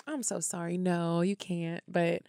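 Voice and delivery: soft, slow polite refusal; gentle letdown. Retold or imitated. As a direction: turn him down softly, like breaking it gently. Letting the man down gently.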